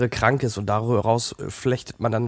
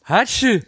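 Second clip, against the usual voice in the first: loud staged sneeze; sneezing; 10 semitones higher, 5 dB louder than usual. Acted. Wie ihr hört, angeschlagen.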